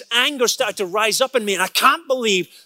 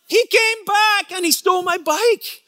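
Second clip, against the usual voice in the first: outraged disbelief; 11 semitones higher than usual. Retold or imitated. Pastor reliving the moment his bike was stolen, outraged. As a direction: yelp it like you can't believe it.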